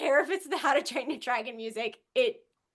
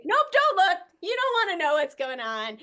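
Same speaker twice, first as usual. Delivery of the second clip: high, louder hurried warning; urgent warning; 9 semitones higher, 6 dB louder than usual. Retold or imitated. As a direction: blurt it high to stop him looking. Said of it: "and she's like".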